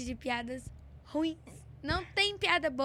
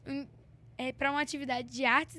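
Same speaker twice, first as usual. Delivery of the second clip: ordinary talk, nothing marked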